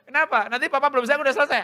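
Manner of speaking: put-on high, quick, loud kid voice; confident child to father. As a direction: tease Dad, fast and high. Acted dialogue of child and father. (suara anak tinggi, cepat, yakin)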